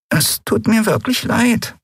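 slow, high, small apology; remorseful. A narrator's reading. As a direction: apologise meekly and sincerely. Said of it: "sagte sie noch einmal". (kleinlaut entschuldigend)

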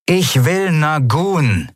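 the captain orders the guards over the speaker; Zaylar over loudspeaker. Acted. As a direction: command from a distant loudspeaker. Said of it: "rauschte eine vertraute Stimme aus einem Lautsprecher".

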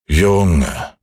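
ein Hauch von altem, geduldigem Mond; milde, gelassen mahnend; gutmütiger Mond. Acted. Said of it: "sagte der gute alte Mond". (leicht gespielte Mondstimme)

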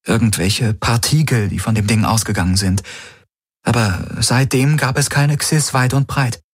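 Kirai's voice. Acted. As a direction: speak as Kirai, caring for the wounded.

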